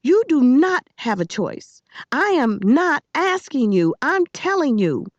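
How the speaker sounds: high, emphatic ultimatum; adamant command. A narrator's reading. Girl giving the boy no choice, adamant.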